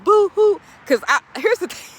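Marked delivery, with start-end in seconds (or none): 0.0-0.6 high, loud mock sobbing boo-hoo. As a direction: whine "boo-hoo" like a fake crybaby. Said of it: "I rarely cry".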